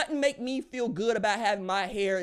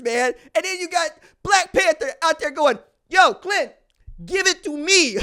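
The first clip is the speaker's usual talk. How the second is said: loud, high, rapid geeked-out rant; gushing about the Avengers at full volume; excited shouting; 9 semitones higher, 11 dB louder than usual. Real, not acted. Sports host gushing over Marvel heroes, excited shouting. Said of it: "I was so excited".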